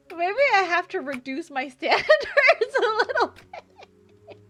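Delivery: giggling through the words; laughing. Real, not acted. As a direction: laugh while saying it, rising high. Laughing at her own boast.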